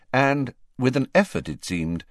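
usual speaking voice

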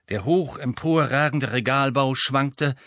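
usual speaking voice

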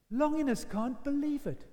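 ordinary talk, nothing marked

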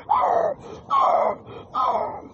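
loud, high mock dog howl in bursts; imitated dog howl-cry. Imitating the dog crying over the body, loud howl. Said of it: "He just starts crying".

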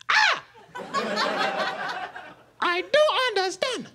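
high, slow, outraged African auntie; aunt's African accent. Retold or imitated. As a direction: squeal then drag out the disbelief. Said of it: "She was like".

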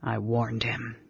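low, soft, worried remark; said softly. A narrator's reading. Caramon hearing his brother's cough, soft and low. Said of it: "Caramon said softly".

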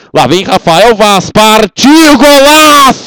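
rising shout into goal; building to goal call. Real, not acted. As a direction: build then erupt on golaço. Free kick goal call.

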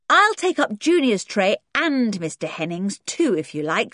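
an offer with a fierce edge under the kindness; half kind, half fierce. Acted. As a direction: kind words pushed out a little fiercely. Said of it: "in a half kind, half fierce voice".

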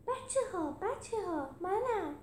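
high-pitched character calling to friends; high, keen little call; eager Felix. Acted. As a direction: pipe up high to get their attention.

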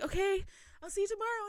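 high, quick little goodbye; flustered retelling. Retold or imitated. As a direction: reenact a giddy, rushed reply in a high voice. Retold shy starstruck reply to idol.

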